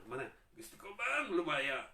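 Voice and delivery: high, loud, slow plea; possessed man pleading. Acted. Preacher voicing the tormented man in the tombs, slow high plea. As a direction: cry out longing to be free. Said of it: "Sabi niya".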